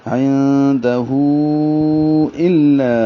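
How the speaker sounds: عندہ الا with the "hu" held long before the hamza; elongated madd. Shown as a demonstration. Teacher shows the four-count madd, elongated. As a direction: stretch the end of عندہ, then start الا firmly. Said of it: "لمبا کریں گے چار حرکات".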